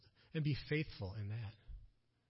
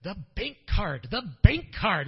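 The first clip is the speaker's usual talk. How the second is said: loud, high, insistent cry; urgent shout about the bank card; excited urgent repeat; 5 semitones higher, 12 dB louder than usual. Retold or imitated. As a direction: hammer it home, louder each time. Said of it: "slapping my knee and going".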